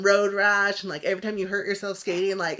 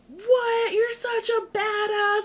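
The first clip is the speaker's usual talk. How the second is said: very high, retold disbelief; high-pitched impressed friends, reenacted; amazed admiration; 13 semitones higher, 5 dB louder than usual. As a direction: gush in mock astonishment, pitch way up. Acts out friends' amazed reaction.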